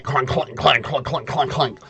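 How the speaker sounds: rapid run of hollow mouth-made clanks; imitated armor clunking. Game master voicing armor turning around, clunking noise. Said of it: "and you hear, like".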